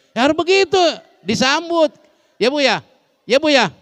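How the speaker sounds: high, loud excited cry to the audience; excited exclaim. Real, not acted. Preacher urging the women in the audience, high and loud. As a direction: pitch it up and call out eagerly. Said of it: "preacher exclaiming to audience".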